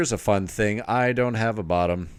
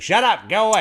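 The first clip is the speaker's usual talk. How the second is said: high, loud mock mom shout; brusque tough-love mom; 11 semitones higher, 6 dB louder than usual. Co-host joking as friend's tough-love mom, loud mock scolding. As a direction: imitate a mom dismissing her kid, loudly. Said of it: "Your mom's like".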